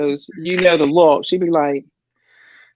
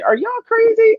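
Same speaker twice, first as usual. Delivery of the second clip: high, indignant disbelief; incredulous; 14 semitones higher, 4 dB louder than usual. Retold or imitated. Telling the recruiter off in disbelief. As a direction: scoff at the recruiter's idea.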